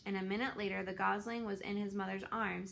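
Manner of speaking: plain reading voice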